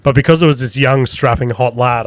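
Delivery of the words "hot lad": ordinary delivery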